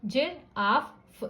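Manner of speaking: Giraffe slowly, with a pause between the syllables; drawn-out giraffe broken into parts; teacher dividing giraffe for kids; split into syllables. Shown as a demonstration. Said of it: "एफ एफ के बीच में break दे रहे हैं".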